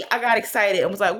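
normal talking voice

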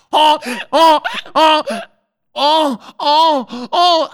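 high, loud repeated "oh"; imitated radio drop; homemade soundboard effect. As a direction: vocalize a high looping clip. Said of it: "I have to do the sounds myself".

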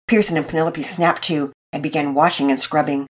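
usual speaking voice

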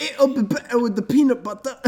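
high, hammed-up British accent. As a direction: put on a posh British accent. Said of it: "Brilliant British accents".

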